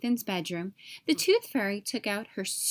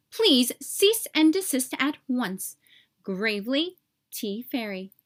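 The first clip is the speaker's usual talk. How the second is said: officious fairy bureaucrat. Acted. Tooth fairy's form letter to Nathan, stiff officious bureaucrat. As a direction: read it like a dry regulation clerk.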